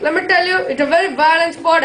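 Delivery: normal talking voice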